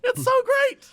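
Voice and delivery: high, loud gushing; excited. Real, not acted. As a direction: gush with delight. Gushing about Virgin's safety song.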